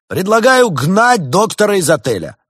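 high, loud public motion; ringing denunciation; accuser's role. A narrator's reading. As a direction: proclaim it like a prosecutor. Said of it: "Делец снова вошёл в роль обвинителя".

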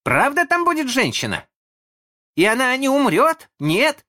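put-on very high, loud child voice; high, insistent child's questions; little twin crying out. A narrator's reading. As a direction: pipe up like an anxious little boy. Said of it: "закричал первый близнец".

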